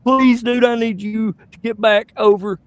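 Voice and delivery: high, urgent plea; pleading. Retold or imitated. Dad begging his son back from the stall, high-pitched plea. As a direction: beg the boy to come back. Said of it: "And I'm like".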